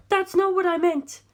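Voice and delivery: put-on high, pleading young woman's voice; lifted female voice, anxious and imploring. A narrator's reading. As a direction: voice a distraught heiress begging for help. Darlington objects.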